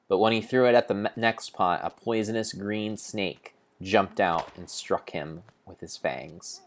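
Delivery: usual speaking voice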